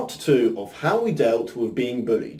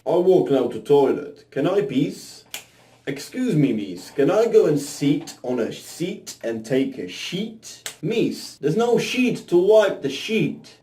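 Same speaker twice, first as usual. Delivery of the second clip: bad put-on Polish accent, broken English; terrible Polish accent; 3 semitones higher than usual. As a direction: ham up a clumsy Polish accent. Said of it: "That was a terrible Polish accent".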